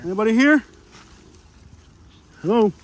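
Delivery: loud call into the camp. Real, not acted. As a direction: call out to see if anyone's there. Calling out into homeless camp.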